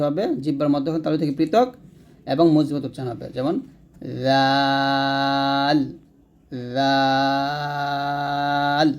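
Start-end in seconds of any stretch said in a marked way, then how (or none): 4.2-5.8 জাল drawn out very long and light; long letter demo. Shown as a demonstration. Teacher slowly modelling a light জাল. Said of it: "এরকম পাতলা হবে।". 6.8-8.9 জাল drawn out very long and light; long letter demo. Shown as a demonstration. Teacher slowly modelling a light জাল. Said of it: "এরকম পাতলা হবে।".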